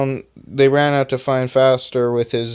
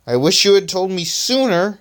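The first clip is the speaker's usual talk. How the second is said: high-pitched mild mock yell; angry yell; 7 semitones higher than usual. A narrator's reading. Flyer scolds Electronic over the pain, mildly angry. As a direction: complain crossly, pitched up without much volume. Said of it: "yelled Flyer angrily".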